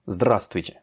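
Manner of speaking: "Здравствуйте" stressed on ЗДРА, falling after; stress on first A. Shown as a demonstration. Teacher repeating the model word, stress on ЗДРА in "Здравствуйте". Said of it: "sur le A".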